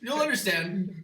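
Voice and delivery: mysterious. Retold or imitated. Cryptic Vince line.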